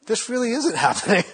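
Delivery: high, dawning realisation in mock voice; won over by doubt. Meditator starting to believe the mind's complaints, mock dawning conviction. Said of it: "you go".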